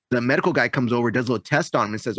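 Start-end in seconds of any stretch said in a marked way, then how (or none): none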